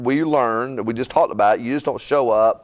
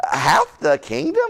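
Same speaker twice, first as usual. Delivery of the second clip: usual speaking voice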